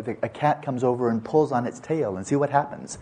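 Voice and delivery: ordinary delivery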